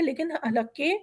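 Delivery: usual speaking voice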